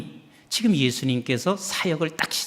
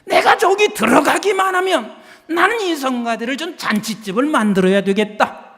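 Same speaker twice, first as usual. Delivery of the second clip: high, loud, determined inner vow; resolute vow; 8 semitones higher, 4 dB louder than usual. Retold or imitated. A pastor voicing a choir member's resolve, high and firm. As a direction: declare it like a new choir member.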